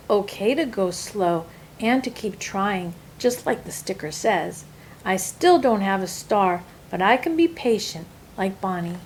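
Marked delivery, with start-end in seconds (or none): none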